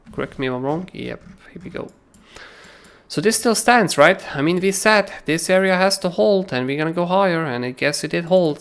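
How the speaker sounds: normal talking voice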